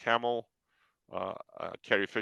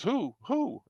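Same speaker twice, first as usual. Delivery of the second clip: curious wife. Retold or imitated. Wife eagerly asks who.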